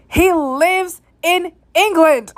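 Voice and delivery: slow, high, drawn-out "he lives in England"; "England" drawn out, about to laugh; incredulous emphasis. Real, not acted. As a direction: stretch out "lives" and "England" in disbelief. Exasperated at absurd accusations, laughs after.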